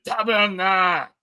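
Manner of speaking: joking Québécois oath; mock Québécois swear. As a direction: shout Tabernacle like an outraged Quebecer. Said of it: "I'll say".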